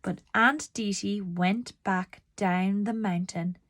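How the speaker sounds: usual speaking voice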